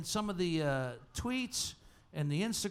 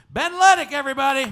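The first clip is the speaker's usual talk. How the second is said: high, very loud name announcement; announcer's shout; 8 semitones higher, 13 dB louder than usual. Real, not acted. Host calls a contestant for applause, shouted announcement. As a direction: belt the name out to rouse the crowd.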